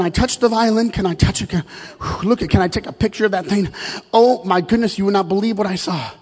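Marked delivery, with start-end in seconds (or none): none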